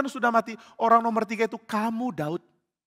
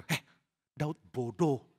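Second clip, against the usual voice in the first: low, hushed grumble; muttered aside; 4 semitones lower, 8 dB quieter, slower than usual. Acted. Followers grumbling privately about their leader, hushed and low. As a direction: say it under your breath, low. People below privately mocking David.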